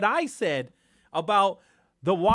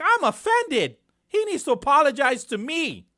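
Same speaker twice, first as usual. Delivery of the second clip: loud, high outburst of indignation; mock-offended shouting; 6 semitones higher, 6 dB louder than usual. Host mimics taking offense at President Trump's orders, mock-offended shouting. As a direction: shout it like someone hugely affronted. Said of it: "Sorry, I'm shouting".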